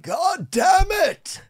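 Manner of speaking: very high, drawn-out yell; father's shout. Retold or imitated. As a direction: stretch the curse out like a woken dad. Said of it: "he'd be like".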